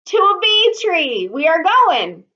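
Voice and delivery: high, loud and puffing while pedaling; breathless call from a bike. Acted. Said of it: "puffed as he pedaled by".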